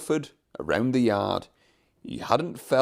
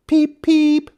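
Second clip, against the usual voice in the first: very high, loud whistling peep; happy engine whistle; 8 dB louder than usual. Acted. Trevor whistling with joy at being saved, high and loud. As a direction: toot it like a happy little engine. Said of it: "whistled Trevor, happily".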